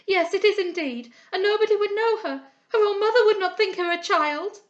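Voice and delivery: high, loud, grief-stricken lament; horrified girl. A narrator's reading. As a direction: wail over the ruined face of a friend. Friend horrified at sickbed.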